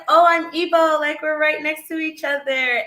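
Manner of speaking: animated quote of yourself; eager greeting. Retold or imitated. Retelling an eager greeting to fellow African.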